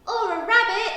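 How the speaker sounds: loud, high eager suggestion; excited child. A narrator's reading. Ruby suggests another pet to the family, excited. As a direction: pipe up loudly with a new idea.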